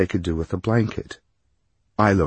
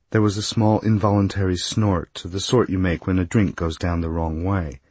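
ordinary delivery, nothing marked

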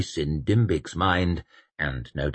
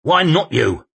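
gruff retort; 9 semitones higher, 6 dB louder than usual. A narrator's reading.

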